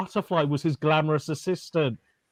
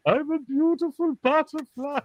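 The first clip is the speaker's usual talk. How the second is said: high-pitched German caterpillar voice; Heimlich; 11 semitones higher than usual. Retold or imitated. Fan quoting the German caterpillar turned butterfly, high character voice. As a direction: coo it high, with a cartoon German accent. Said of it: "Heimlich is, is".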